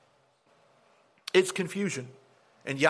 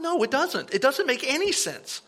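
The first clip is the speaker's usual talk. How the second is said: raised high, sharp "No, it doesn't"; indignant higher retort; exasperated retort; 9 semitones higher than usual. Retold or imitated. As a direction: fire back in disbelief. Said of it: "I'm like".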